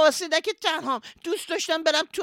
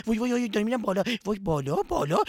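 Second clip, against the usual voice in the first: ordinary talk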